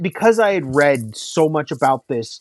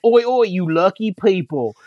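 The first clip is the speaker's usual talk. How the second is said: lightly voiced cockney messenger in English accent; tossing off a ranting English messenger; hint of a rowdy English bike courier; bike messenger impression; 4 semitones higher than usual. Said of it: "the bike messenger that's, that's like".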